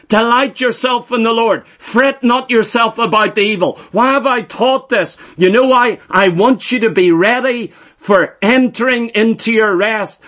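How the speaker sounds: ordinary talk, nothing marked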